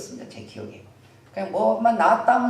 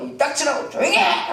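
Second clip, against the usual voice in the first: high acted hushing shout; scolding that jumps up in pitch; yelling; 6 semitones higher than usual. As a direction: shut someone up crossly. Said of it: "사람이 막 소리를 지른 거예요".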